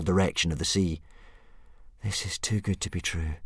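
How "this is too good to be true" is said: to himself. Acted. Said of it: "Hiccup said to himself".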